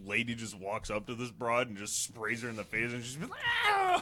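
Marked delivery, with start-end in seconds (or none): 3.7-4.0 sudden high yelp of pain; short piercing cry; mock scream. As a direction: shriek like you just got pepper sprayed. Lady sprayed in the face.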